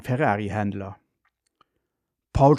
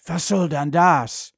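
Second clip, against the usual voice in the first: grumble, then wail for what you want; ranting; 7 semitones higher than usual. A narrator's reading. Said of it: "Vater wettert". (wetternd, immer höher und lauter)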